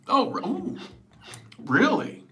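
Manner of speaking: high, gushing fake amazement; squealing oh really wow; mock amazed. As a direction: play it overly impressed. Mock impressed reaction quote.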